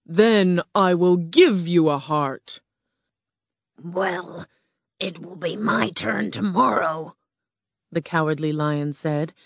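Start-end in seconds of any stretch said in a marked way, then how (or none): 0.1-2.6 slow, booming beast voice of Oz; grand slow roar from a huge animal. Acted. Oz's beast voice continues. 3.8-7.1 lightly voiced timid lion; a hint of a nervous big cat; cowardly lion. Lion voice in story.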